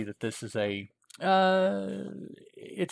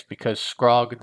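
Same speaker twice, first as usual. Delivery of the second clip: ordinary delivery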